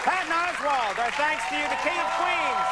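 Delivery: high, projected outro over band music; show host sign-off. Real, not acted. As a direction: send it to the break like a TV host. Host signs off to break.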